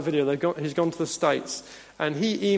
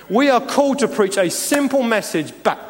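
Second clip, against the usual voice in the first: higher, louder pulpit build; rising preaching; 4 semitones higher, 3 dB louder than usual. Acted. Pastor urging his church to be supernatural, higher and louder preaching. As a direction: drive each claim home to the church.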